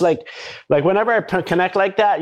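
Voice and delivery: ordinary talk, nothing marked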